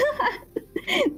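high mocking cackle; evil laugh. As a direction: laugh him off, gleeful. Babysitter refuses to open door.

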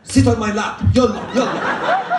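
loud, insistent comic instructor; pushy instructor. Acted. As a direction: order the student over, loud and urging. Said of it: "and my instructor goes".

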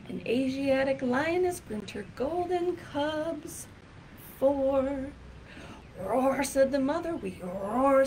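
lively, sing-song picture-book reading; reading to children. Acted. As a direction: read it out like storytime for a class.

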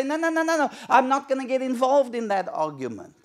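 high, fussy refusal; mock the cowering believer; a frightened no-no-no; timid churchgoer. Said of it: "And we say".

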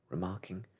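usual speaking voice

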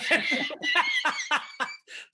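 big high burst of laughter; a loud laugh as a friend teases him. Real, not acted. Laughs at the joke.

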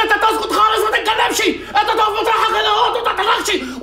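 high mock shout through laughter; imitating her shout. Coffee man retelling the lawyer's order, mock shout. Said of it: "قالت لي".

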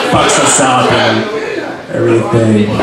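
ordinary talk

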